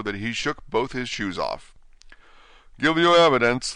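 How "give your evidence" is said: higher, louder angry repeat; angry king. Acted. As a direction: repeat the order angrily. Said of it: "the king repeated angrily".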